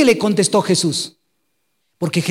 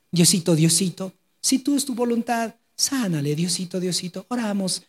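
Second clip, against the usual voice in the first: timid, softer pleading, mock whimper of a fearful believer; mocking weak prayer; 6 dB quieter than usual. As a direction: parody a limp, begging prayer. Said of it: "No oramos así". (burla de una oración débil y suplicante, más suave)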